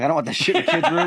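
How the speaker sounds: high, hurried protest through laughter; laughing mock alarm. Dad casting adult stuff by accident, laughing mock alarm. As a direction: blurt it high and fast, half laughing. Retold panic over phone casting.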